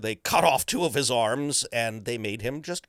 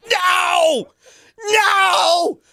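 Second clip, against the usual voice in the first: high, loud, mock-despairing cries; hammed-up heartbroken yelling; anguished shout; 10 dB louder, slower than usual. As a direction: wail at Ryan Reynolds in mock betrayal. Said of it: "And I went".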